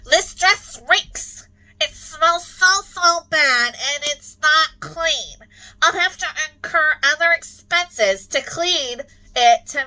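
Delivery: high, loud, slow melodrama; hammy stage actor. Pretend. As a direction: ham up the disgust for the stage. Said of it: "I'll be accepting Academy Award nominations".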